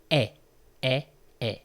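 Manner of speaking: "est" as an open French è, like the e in bet; open è sound. Shown as a demonstration. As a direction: repeat "est" as a separate open eh, pausing between each.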